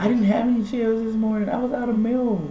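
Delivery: high voice. Acted. Keeps high put-on voice.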